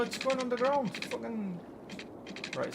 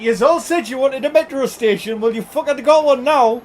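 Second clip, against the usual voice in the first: loud, raised-pitch rant; mock indignant shout; 4 semitones higher, 12 dB louder than usual. Streamer answering chat about the metro station, loud and indignant. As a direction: bellow it back at the complainers.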